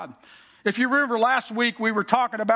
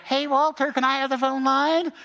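put-on a touch higher sweet old grandma; lightly voiced grandma; 4 semitones higher than usual. Grandma asking Walter for the party line, lightly voiced. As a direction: ask for the line like a polite grandmother.

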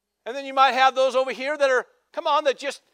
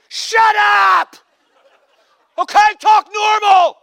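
high, loud fed-up yell; mock irritated shout; 10 semitones higher, 12 dB louder, much slower than usual. Pastor acting out an impatient listener, loud mock yell. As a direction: snap at an imagined squeaky voice. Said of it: "You go, you just wanna".

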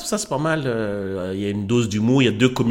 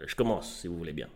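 usual speaking voice

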